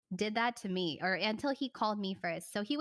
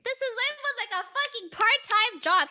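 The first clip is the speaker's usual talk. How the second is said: much higher, louder exasperated rant; indignant outburst; 14 semitones higher, 8 dB louder than usual. Real, not acted. Storyteller outraged at a boyfriend's probation period, loud high rant. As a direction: vent the absurdity at full volume.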